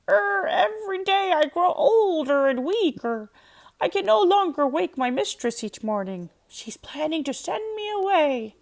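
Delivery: put-on a high old rooster, tired and sorry. A narrator's reading. Rooster tells Donkey his troubles, high sad voice.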